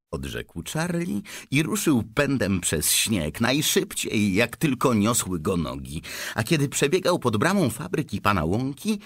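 ordinary delivery, nothing marked

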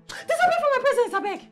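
high, loud, exasperated order; angry dismissal. Acted. Boss banishing someone from her presence, angry dismissal. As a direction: send them away at the top of your voice.

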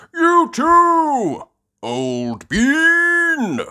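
very high, loud, drawn-out reply; Grampy Rabbit. Acted. Grampy Rabbit returns the greeting, loud hearty boom. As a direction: boom it back with long vowels. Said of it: "replies Grampy Rabbit".

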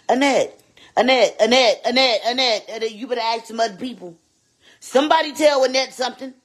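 high loud repeated call. Real, not acted. Streamer calling out viewer Annette, loud and high. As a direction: call her name across the room.

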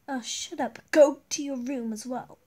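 loud snapped command; fed-up mum ordering; angry order. Acted. Mom sending kids away.

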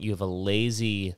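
normal talking voice

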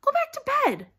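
very high, loud angry order; furious high-pitched yell. A narrator's reading. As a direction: order them off in outrage. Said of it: "said Ron furiously".

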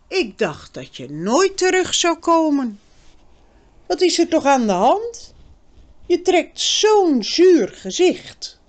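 put-on girl's voice, worried and pushy; Birgit, anxious girl. A narrator's reading. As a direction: press him with worry as the girl.

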